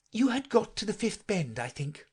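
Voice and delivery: put-on timid girl. A narrator's reading. Alice tries to help.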